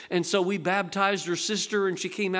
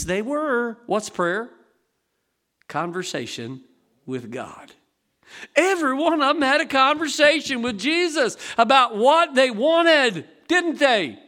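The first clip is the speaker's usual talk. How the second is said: ordinary talk, nothing marked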